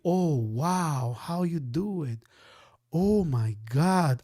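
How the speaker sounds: mock wonder, like a stunned student; amazed viewers. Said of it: "you guys are gonna be like".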